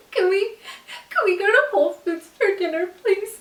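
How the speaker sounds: high, mock-tearful plea; imitating a pitiful crying request; full-on crying. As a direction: beg in a pretend sobbing voice. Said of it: "like, full on crying".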